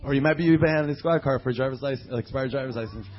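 normal talking voice

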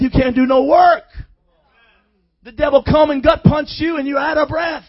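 loud, driving sermon delivery; fired-up preaching. Real, not acted. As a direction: preach it hard to rouse the congregation. Preaching at full force.

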